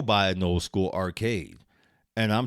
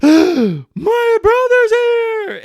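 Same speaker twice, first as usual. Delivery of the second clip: stretched, very high, loud shriek of joy; imitating sister's shriek; 15 dB louder, much slower than usual. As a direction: squeal it like she just saw him.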